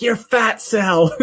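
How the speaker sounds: high, slow, laughing jab; mocking insult. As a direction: tease it like a schoolyard taunt. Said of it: "uses it to insult her".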